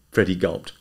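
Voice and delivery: usual speaking voice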